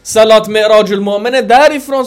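därifrån hit loud and long; stress on därifrån. Real, not acted. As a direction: land hard on därifrån. Preacher stresses the location again.

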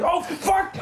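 high startled yelp, laughing. Retold or imitated. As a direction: yelp it in fright. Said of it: "He's like".

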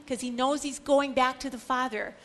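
ordinary talk, nothing marked

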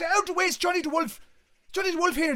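high, loud celebrity-escort yell; urgent shouting. Retold or imitated. Lads barging past people at the queue front, urgent shouting. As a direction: shout them aside, almost laughing.

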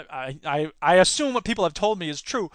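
normal talking voice

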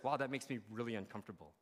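low, soft, quick mock discomfort; uneasy aside. Guest sitting next to Jesus during worship, uneasy aside. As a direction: murmur it like an embarrassed onlooker.

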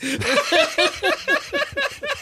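squealing laugh; helpless high-pitched laughter; high giggle fit. Real, not acted. Laughing at own story.

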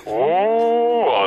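high, eerie ghost wail; unearthly voice. Pretend. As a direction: wail it like a spirit. Ghost voice game.

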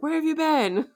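high-pitched mock upset demand; upset Layla. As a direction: play an upset wife or girlfriend. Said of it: "the way she kinda sounds upset".

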